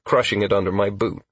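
ordinary delivery, nothing marked

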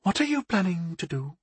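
high, soft, wary question; disapproving goddess. A narrator's reading. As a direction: ask warily in a hushed high voice. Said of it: "her tone sounding like a mixture".

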